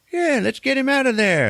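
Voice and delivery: high-pitched excited rescuer voice; mock high-voiced fisherman rallying the crew; eager fisherman. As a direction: call out high and urgent, imitating a fisherman. Acts out fishermen rescuing the pod.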